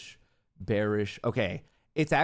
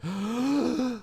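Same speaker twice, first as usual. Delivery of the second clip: high sharp intake of breath; a real startled gasp; 13 semitones higher than usual. Real, not acted. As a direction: gasp in tense suspense. Order about to submit.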